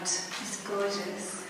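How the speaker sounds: ordinary delivery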